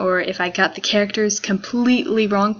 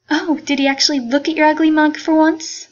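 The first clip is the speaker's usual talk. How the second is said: higher, louder mocking sneer; mocking jerk; 7 semitones higher, 5 dB louder than usual. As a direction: taunt her cruelly, pitch up. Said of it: "being a jerk thing".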